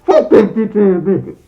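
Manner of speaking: put-on very high, loud squealing kid curse; muffled kid rage. Boy curses his dad in his room, loud high muffled squeal. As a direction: squeal it furious through a shirt. Child cursing dad again.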